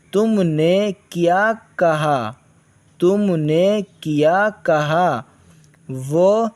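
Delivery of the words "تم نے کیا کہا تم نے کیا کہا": slow dictation. Shown as a demonstration. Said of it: "teacher reads slowly".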